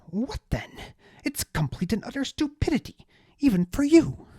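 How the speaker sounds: high, loud, outraged aristocrat ranting; indignant high-voiced noble. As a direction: spit out the betrayal in a high indignant voice. Deliritus voice.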